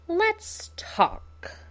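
high, sing-song, playful menace; Joker voice. Acted. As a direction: purr it like a gleeful captor.

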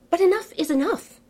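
high, loud, fed-up declaration; mock despair. A narrator's reading. Narrator giving up on the endless wait, mock despair. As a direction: throw up your hands in mock defeat.

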